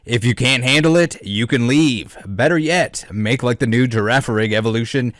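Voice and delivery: loud disclaimer. Pretend. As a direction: boom out the warning like an announcer. Reading the disclaimer.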